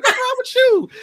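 high, loud incredulous question; squealed mock exasperation; mocking cousins. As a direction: imitate kids yelling at a scaredy-cat. Cousins question frightened child.

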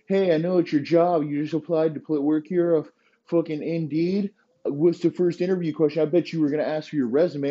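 high, pushy mocking startup boss voice; hammed-up edgy founder grilling a new hire; mock tech CEO. Mocking a startup CEO asking job applicants if they're assholes, pitched high. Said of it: "'Cause his first question is like".

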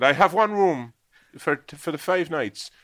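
high, loud and slow landlady voice; landlady's voice. Retold or imitated. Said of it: "And she said".

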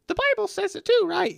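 high, silly voice of a gullible listener; mocking naive voice. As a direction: squeak it out like a dopey kid.

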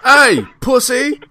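loud, drawn-out Irish heckle; Irish accent. Pretend. Mock Irish accent taunting his wrestling rival. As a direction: bark it in a mock Irish brogue. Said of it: "That's my Irish accent".

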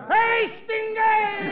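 high calling shout of Hey, Stingay!. Retold or imitated. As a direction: yell Stingay! across the street, high. Calling out old nickname, Patterson.

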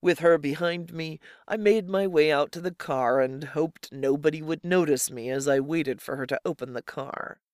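ordinary delivery, nothing marked

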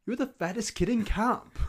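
high, lightly voiced movie line; quoting Heavyweights; movie quote. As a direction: toss off a quote from the film. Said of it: "it's Heavyweights".

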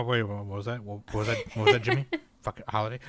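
a hint of a flustered announcer; lightly voiced stumbling announcer; confused announcer. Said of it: "the announcer guy just stumbling on his words".

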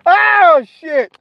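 very high, loud "oh"; loud exclamation. Real, not acted. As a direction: cry out in disbelief.

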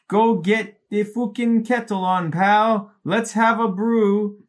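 put-on high, slow Scottish accent. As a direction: read the note in a hammed-up Scottish accent. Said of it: "it's signed in Scottish".

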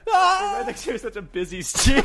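high shrieking laughter; laughing hysterically. Real, not acted. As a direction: laugh through the words. Chaos, laughing hard.

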